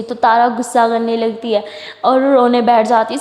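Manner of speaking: ordinary delivery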